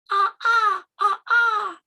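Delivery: high, loud peacock cries; peacock call. Acted. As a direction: cry out in pairs like a peacock. Story's peacock answers.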